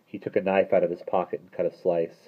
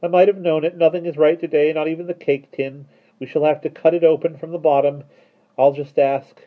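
high, loud lament; despairing; 10 semitones higher, 7 dB louder than usual. A narrator's reading. Mister Turvey unable to open the cake tin, despairing. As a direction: complain in despair, pitched up. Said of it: "he said despairingly".

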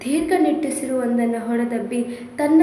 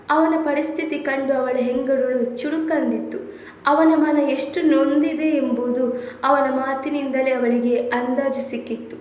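ordinary delivery, nothing marked

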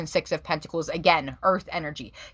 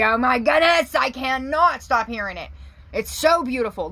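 higher, louder burst of delight; thrilled; 7 semitones higher, 5 dB louder than usual. Real, not acted. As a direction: gush over the cards, up high. Thrilled by the cards.

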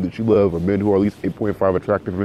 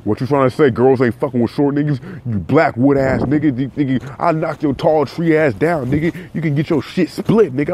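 mock irate reply; 5 semitones higher than usual. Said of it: "some people were a little irate".

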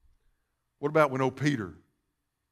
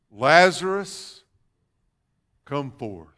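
slow solemn call; slower than usual. Acted. Jesus at the tomb, slow solemn call. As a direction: call him out of the grave slowly.

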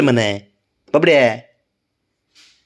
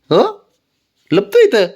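squeaky pet talk; 10 semitones higher, 5 dB louder, slower than usual. Real, not acted. Teasing pet in hiding spot.

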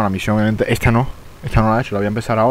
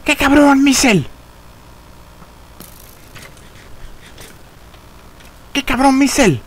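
high, loud indignant yell; outraged yell; 4 dB louder, slower than usual. Real, not acted. Streamer yells at Michel after being killed, high outrage. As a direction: yell the name in disbelief at the betrayal. Cursing the player who killed him.

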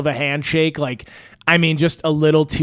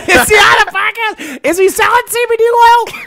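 mocking kid voice; 8 dB louder than usual. Host echoing the child who walked in, in a high, loud mock kid voice.

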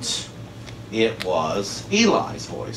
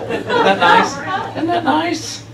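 mock sweet, much higher, slow; sing-song sarcasm about the Bible's wording; sarcastic; 4 dB louder, slower than usual. Preacher mocking the Bible's phrasing, sarcastic sing-song.

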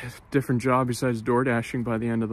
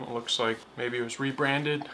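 normal talking voice

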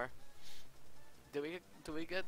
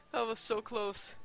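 relieved; 7 semitones higher, slower than usual. Real, not acted. Exhaling after a near loss.

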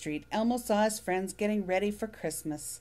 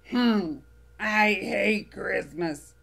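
slow, louder grumpy grouch; Oscar grouch; 6 dB louder, much slower than usual. Acted. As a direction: grumble it like a sour old grouch. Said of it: "said Oscar".

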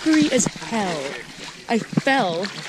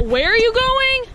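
calling out; 12 semitones higher, 4 dB louder than usual. Real, not acted. Repeated call to friend.

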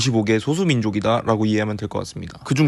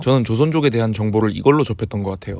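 ordinary delivery

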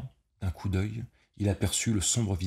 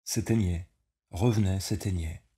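ordinary delivery, nothing marked

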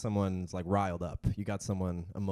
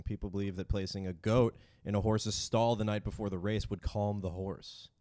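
normal talking voice

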